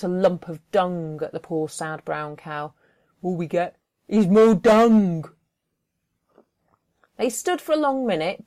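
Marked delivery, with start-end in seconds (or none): 3.2-5.2 loud sour grumble; sour Dillard. Acted. Dillard grumbling about the dung, loud and sour. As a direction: grumble it bitterly.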